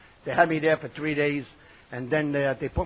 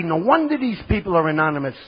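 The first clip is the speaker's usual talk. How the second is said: loud, high comic outrage; retold exasperated rant; indignant outburst; 5 semitones higher, 6 dB louder than usual. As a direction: protest loudly to a friend, pitch climbing.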